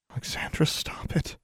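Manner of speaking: soft, whispered protest; hushed plea. A narrator's reading. Distressed mother to her daughter, hushed plea. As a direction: beg her to stop, under the breath. Mother pleading, hands over face.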